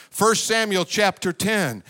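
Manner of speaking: normal talking voice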